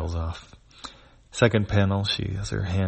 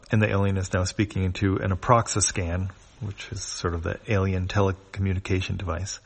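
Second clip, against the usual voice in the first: usual speaking voice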